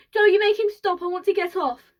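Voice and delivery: high, loud demand to be let off; panicked yelling. A narrator's reading. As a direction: yell in alarm over the noise. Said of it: "yelled Grandma Josephine".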